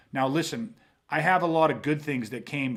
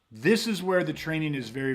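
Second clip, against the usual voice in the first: ordinary talk, nothing marked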